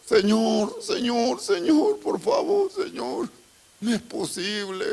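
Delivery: high, crying plea to the Lord, near shouting; desperate pleading. Acted. Moses begging God to forgive his sister, high anguished crying plea. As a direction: beg God for her life, voice breaking.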